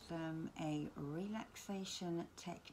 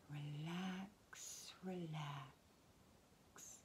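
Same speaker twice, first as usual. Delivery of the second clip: very soft, drawn-out whisper; whispered soothing cue; 8 dB quieter, much slower than usual. Acted. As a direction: let each word sink away in a slow whisper. Soothing cue within guided relaxation.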